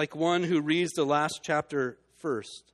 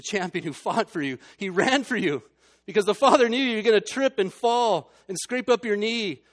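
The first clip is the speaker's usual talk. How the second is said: louder, higher, pressing faster at the end; urgent preaching; 6 semitones higher, 9 dB louder than usual. Acted. Preacher assuring struggling listeners, urgent rising preaching. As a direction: plead it out, gathering speed.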